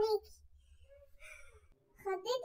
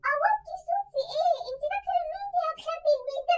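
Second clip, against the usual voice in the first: defiant; 6 semitones higher than usual. Acted. Sister refuses to lower voice.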